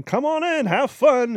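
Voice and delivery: high, bright come-on-in call; cheery welcome. Retold or imitated. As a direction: welcome them in like a cheerful host.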